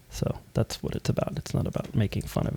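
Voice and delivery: near-whisper reassurance; almost whisper it, softly sincere; whispered aside. Real, not acted. Apologising to viewers about accents, near whisper.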